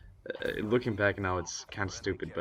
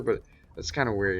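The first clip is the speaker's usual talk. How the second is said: normal talking voice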